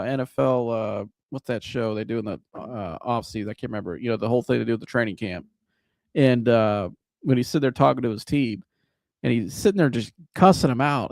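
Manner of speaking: ordinary talk, nothing marked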